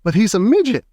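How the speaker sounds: high shocked shout. A narrator's reading. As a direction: blurt it out aghast. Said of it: "shouted Mister T V".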